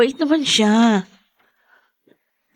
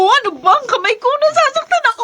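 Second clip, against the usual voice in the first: pitched way up, pleading in pain; pained protest; 12 semitones higher than usual. Acted. Wife begs husband to let go of her hand, in pained high protest. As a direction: cry out as he twists her hand.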